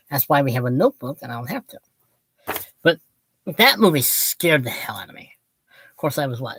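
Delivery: ordinary delivery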